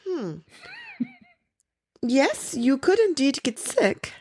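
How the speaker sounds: a hint of South African lilt, gently correcting; South African accent. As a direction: mimic a polite South African professor. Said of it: "the South African accent".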